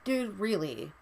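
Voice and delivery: usual speaking voice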